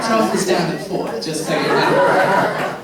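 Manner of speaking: laughing through a cheeky joke. Real, not acted. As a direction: tease him with a laugh in the voice. Flirty joke to audience man.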